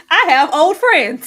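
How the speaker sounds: mocking imitation of a co-host, loud and drawn out; Ashley. Co-host parodies her friend denying she is ageist, mocking imitation. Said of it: "That's exactly how she sounds".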